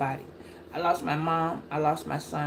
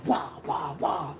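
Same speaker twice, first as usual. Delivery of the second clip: mock chatter; 5 semitones lower, 4 dB quieter, slower than usual. Mocking people running their mouths.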